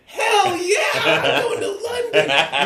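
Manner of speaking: high, drawn-out whooping shout; hammed-up shout of glee, stretched out; excited American cheer. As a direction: whoop it like a thrilled American crowd. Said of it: "everybody in America's like".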